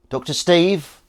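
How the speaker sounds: high, loud delighted call; light up at seeing a regular; surprised greeting. Real, not acted. Spotting Doctor Steve in the chat, high and loud.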